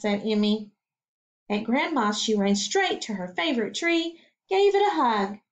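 usual speaking voice

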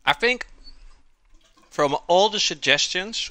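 talking through a mouthful of food; mouth full. Real, not acted. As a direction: speak around a big bite. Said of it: "eating with my mouth full".

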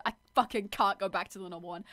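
ordinary delivery

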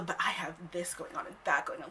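whispered whiny excuse; mocking whisper. Mocking the guy's busy excuse, whispered. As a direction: breathe out his excuses mockingly. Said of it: "all the".

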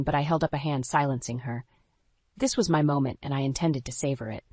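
plain reading voice

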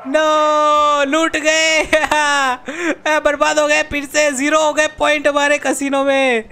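high, drawn-out mock wail; hammed-up lament of a ruined gambler. Gamer bemoans a total casino loss to viewers, mock wailing.

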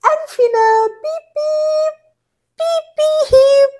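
high squeaky beeping. Acted. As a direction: beep like a toy. Touching noses playfully.

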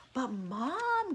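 mild gasped protest. A narrator's reading. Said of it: "gasped Eddie".

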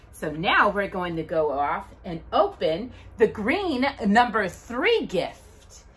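ordinary talk

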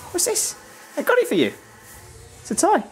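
high sing-song dog voice; talking to a dog. Real, not acted. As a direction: coo at the dog.

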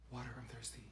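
barely audible, hushed and low; whispering. Acted. Shy guest repeating request to friend, whispering. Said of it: "Why are you whispering?".

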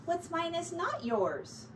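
slow and cross, NOT leaned on; a mildly cross little mouse correcting him; firm, slowed, a touch of annoyance. Acted. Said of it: "said Mouse crossly".

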